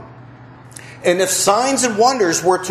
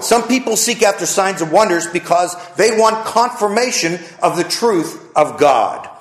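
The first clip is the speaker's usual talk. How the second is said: normal talking voice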